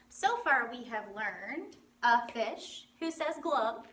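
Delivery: ordinary talk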